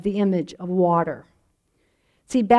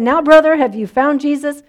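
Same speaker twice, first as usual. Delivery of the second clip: louder preacher pressing; 6 semitones higher, 7 dB louder than usual. Retold or imitated. Preacher asks again, more insistent.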